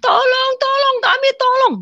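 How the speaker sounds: high, loud desperate cries of tolong; crowd crying for help. Retold or imitated. Souls in the grey wasteland screaming to be saved, desperate. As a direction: wail for help like lost souls. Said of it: "semua manusia teriak-teriak minta tolong".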